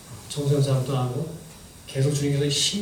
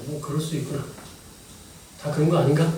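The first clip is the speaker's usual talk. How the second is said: ordinary delivery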